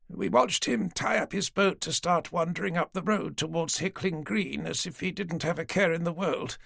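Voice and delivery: put-on an old lady patiently humouring the police; elderly lady. Dorothy naming Jason Baines.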